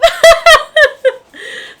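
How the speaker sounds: high, loud real laugh breaking out; burst of laughter. Real, not acted. Vlogger laughing at her own book summary, loud laugh.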